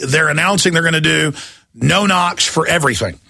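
normal talking voice

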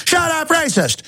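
high-pitched sneering mock shout; mocking accuser. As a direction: squeal it like a hysterical accuser.

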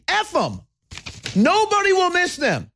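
high, emphatic outburst; angry dismissal. Real, not acted. Host dismissing the correspondents association, angry outburst. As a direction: write them off in exasperated anger.

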